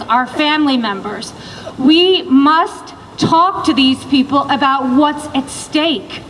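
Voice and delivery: ordinary delivery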